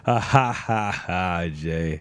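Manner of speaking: lightly voiced amused sidekick; Branford laughing. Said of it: "he'd just be like this".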